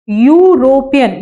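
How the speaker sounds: यूरोपियन drawn out slowly, starting with yoo; slow model word. Shown as a demonstration. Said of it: "क्या बोलते हैं बच्चों".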